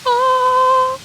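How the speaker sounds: very high, loud sustained "ah"; playful sung "ah". Pretend. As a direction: hold one high note in mock majesty. Admiring own majestic look on camera.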